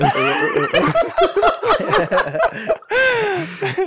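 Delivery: loud, very high laughing fit; real uncontrolled high cackling laugh; laughter. Real, not acted. Group cracks up at the story.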